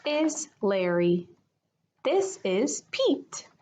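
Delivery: put-on slow, storybook reading voice for kids; gentle picture book read-aloud; story narration. A narrator's reading.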